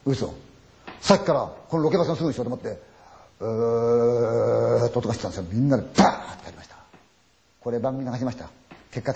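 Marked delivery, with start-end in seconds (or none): 3.4-5.5 slow drawn-out moan sound. Retold or imitated. Storyteller imitating the moan behind the bus, slow eerie moan. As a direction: stretch the moan out eerily.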